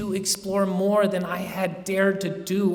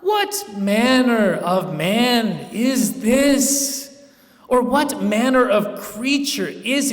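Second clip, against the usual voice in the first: higher, louder and very slow, aghast; frightened exclaim; 6 semitones higher, 5 dB louder than usual. A narrator's reading. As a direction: cry out the question in disbelief. Terrified questions about the Count.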